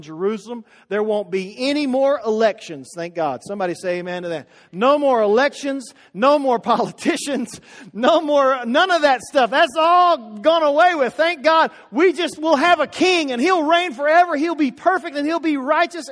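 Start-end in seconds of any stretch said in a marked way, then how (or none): 4.7-16.1 louder, rousing preacher's cry; preaching at full force. Acted. Preacher rousing the church about the coming king, full force. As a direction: whip the congregation up.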